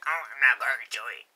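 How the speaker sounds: odd put-on voice for a friend; random quote voice. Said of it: "I just do a random voice whenever I".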